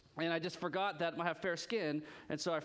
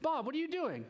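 startled; 8 semitones higher than usual. Retold or imitated. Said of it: "that'd be weird".

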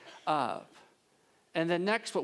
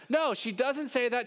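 ordinary talk, nothing marked

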